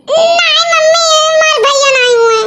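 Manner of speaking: put-on high, crying childlike complaint; a small girl whining to mom and teasing; the girl complaining to her mother that her brother stammers; whiny little girl. Child refuses, high pitched.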